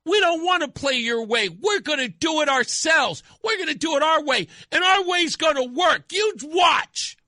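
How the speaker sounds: put-on high, bratty kid insisting on their way; mock a child acting out; defiant children. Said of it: "acting out like children do".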